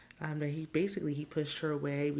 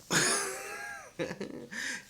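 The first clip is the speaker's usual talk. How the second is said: high squealing laugh. Real, not acted. Laughing at own joke.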